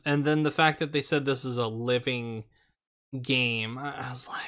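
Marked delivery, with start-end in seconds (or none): 3.8-4.5 whispering. Real, not acted. Aside while cohost talks.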